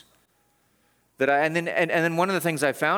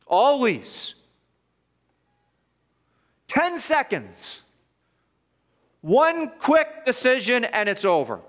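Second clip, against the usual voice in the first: high, loud preacher's cry, stretched words; impassioned preaching; 8 semitones higher, 3 dB louder, slower than usual. Real, not acted. Preacher warning the church how fast faith can wreck, loud and high.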